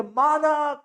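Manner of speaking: mock scream for her son; mother crying out her son's name; calling out. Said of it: "चिल्ला उठती है".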